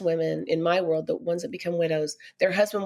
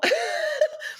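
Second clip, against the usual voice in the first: laughing sob. Real, not acted. Laughing while recalling crying.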